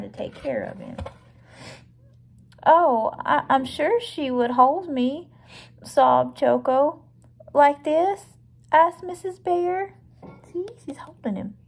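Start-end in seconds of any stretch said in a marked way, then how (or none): 2.6-9.9 louder, clear picture-book reading; read-aloud storybook. A narrator's reading. Choco tells Mrs Bear about hugs, storybook narration. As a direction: read out the dialogue with lifted questions.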